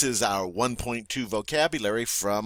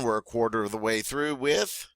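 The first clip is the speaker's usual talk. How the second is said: ordinary delivery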